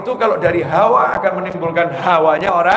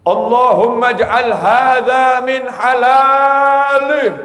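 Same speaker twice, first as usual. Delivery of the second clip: loud, slow, higher chanted prayer; loud prayer call; 7 semitones higher, 6 dB louder, much slower than usual. Retold or imitated. Cleric at a dinner, loud slow prayer over the food. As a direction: call the prayer out loud across the room, drawn out. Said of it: "angkat tangan dengan suara keras".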